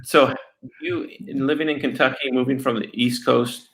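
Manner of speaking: ordinary talk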